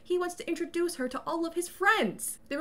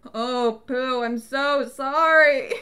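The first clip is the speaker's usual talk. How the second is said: slow, loud, drawn-out apology; hammed-up weepy wail; mock crying; 6 dB louder, much slower than usual. [name] crying as Pooh appears behind him, mock sobbing. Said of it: "he's just standing there crying".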